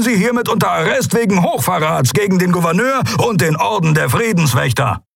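high, projected official proclamation; commanding guard captain. A narrator's reading. Guard captain arresting admirals for treason, commanding. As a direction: call out an arrest formally.

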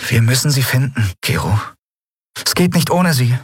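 lightly voiced heroine, pleading; Endriel. (leicht gespielte junge Frau, drängend)

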